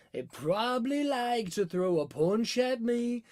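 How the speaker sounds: slow, badly faked British accent. Shown as a demonstration. Asked by chat to do a line, badly faked British accent. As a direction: try a British accent and miss. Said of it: "I don't do the British accent".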